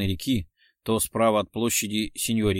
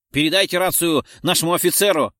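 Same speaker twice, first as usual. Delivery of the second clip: loud, raised, irritated command; angry order; 6 semitones higher, 7 dB louder than usual. A narrator's reading. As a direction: order him off the radio angrily. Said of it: "разозлился Террачини". (раздражённый громкий приказ)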